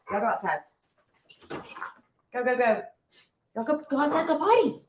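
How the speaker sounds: high, coaxing dog-owner call. Real, not acted. Owner sending puppies outside to go potty, high-pitched coaxing. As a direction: shoo the puppies out in a high pet voice. Sends her dogs outside.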